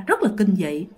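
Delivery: usual speaking voice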